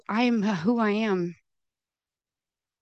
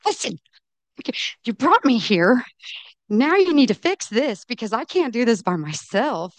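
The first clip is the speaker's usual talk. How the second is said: raised, higher-pitched retold yell; mock yelling at God; 5 semitones higher, 5 dB louder than usual. Standing on the tile, demanding God fix this, in a raised voice. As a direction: reenact shouting at God, a bit hammed-up. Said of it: "I'll try not to yell at you anymore".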